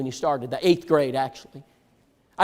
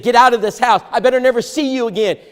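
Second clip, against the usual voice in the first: louder and higher throughout; retold furious order; 7 semitones higher, 6 dB louder than usual. Enraged father ordering the boy out, retold yell. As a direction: throw him out with your voice.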